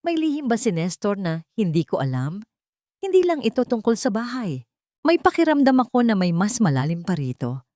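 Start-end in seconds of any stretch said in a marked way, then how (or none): none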